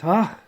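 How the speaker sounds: high, loud startled yelp; a sudden shocked cry as the floor drops; surprised cry. Acted. Cat girl falls through the floor, startled cry. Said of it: "cat girl is surprised and says".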